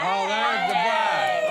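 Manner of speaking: high, drawn-out delighted greeting; excited squeal. Real, not acted. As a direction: greet the bride with a thrilled cry. Greeting the bride loudly.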